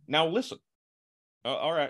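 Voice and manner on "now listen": Obama impression. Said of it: "in a press conference would be".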